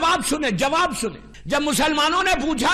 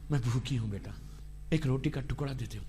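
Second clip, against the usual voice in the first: much softer, lower, hushed plea of a starving mother; hungry mother pleading; 13 dB quieter, faster than usual. Retold or imitated. Preacher voicing a hungry mother begging her son, soft pleading. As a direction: beg for bread like a faint old mother.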